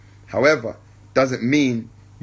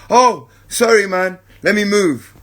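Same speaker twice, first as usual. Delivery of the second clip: higher, louder quick apology; apologetic smoker; 5 semitones higher, 4 dB louder than usual. Retold or imitated. Smoker apologizing to the speaker and moving, higher and louder. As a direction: brush it off with a friendly sorry. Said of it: "He has the opportunity to do two things".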